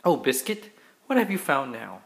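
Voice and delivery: ordinary delivery, nothing marked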